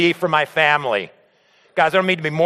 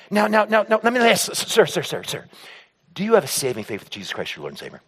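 mock evangelist. Pastor stopping a customer at work, mock solemn evangelizing. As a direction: imitate buttonholing someone, then sink into solemn gravity. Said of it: "So I'm not there saying".

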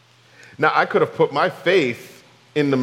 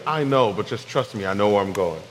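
reassuring God; 6 semitones lower than usual. Pretend.

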